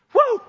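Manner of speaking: loud, high "woo"; sudden high, loud whoop; excited whoop. Real, not acted. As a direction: holler it out in delight. Excited at sharing with Jesus.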